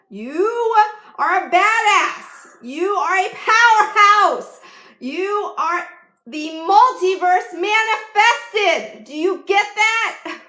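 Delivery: high, drawn-out, very loud affirmation yell; yelling from the gut. Shown as a demonstration. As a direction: yell it as loud as you can to empower them. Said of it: "everything I just yelled at you".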